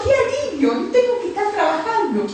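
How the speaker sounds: high-pitched mock relief; alivio imitado. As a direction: play an assistant suddenly let off work. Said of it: "como diciendo". (alivio fingido, en tono agudo)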